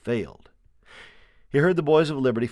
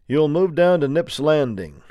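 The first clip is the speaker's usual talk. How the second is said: slow, raised commanding voice; officer giving orders; 6 semitones higher, 7 dB louder than usual. A narrator's reading. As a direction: call the order out to the troops. Officer's orders overheard through shutter.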